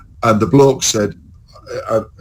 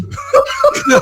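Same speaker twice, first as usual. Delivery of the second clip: real high-pitched giggling laugh; high laughter. Real, not acted. Recalling the sunburn to a friend, high laughter. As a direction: crack up in high peals.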